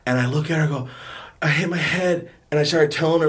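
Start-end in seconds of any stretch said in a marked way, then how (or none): none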